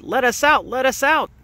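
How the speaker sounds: high mock shout. A narrator's reading. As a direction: Tino calls up high from the belfry. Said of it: "and he shouted".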